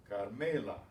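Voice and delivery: "Carmela" with the middle syllable "me" stressed; stress on next-to-last syllable. Shown as a demonstration. Teacher models stress for students, "Carmela" stressed on "me". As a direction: lean on "me" in "Carmela". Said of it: "stressed on the next to the last syllable".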